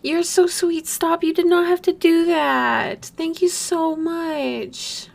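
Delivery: high-pitched, touched thanks, drawn out at the end; overwhelmed gratitude. Real, not acted. Streamer thanking a generous viewer, overwhelmed gratitude. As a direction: gush sweetly, high in the voice.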